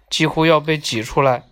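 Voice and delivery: plain reading voice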